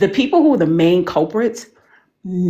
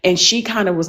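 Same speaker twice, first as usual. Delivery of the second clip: ordinary delivery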